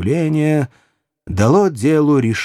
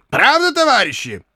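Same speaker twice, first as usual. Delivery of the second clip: high call to the comrades; rallying call; 12 semitones higher than usual. A narrator's reading. Archer asking his comrades to back him, rallying. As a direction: appeal to the whole troop, pitch right up. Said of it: "возразил Меченый".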